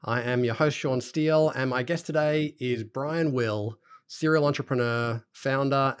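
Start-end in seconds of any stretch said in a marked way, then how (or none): 0.0-1.8 bright, high, loud show opening; excited podcast intro. Acted. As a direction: open the show with big energy. Host opens the podcast.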